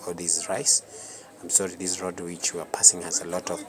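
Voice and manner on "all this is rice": ordinary delivery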